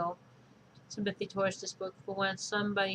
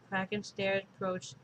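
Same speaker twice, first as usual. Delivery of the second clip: ordinary delivery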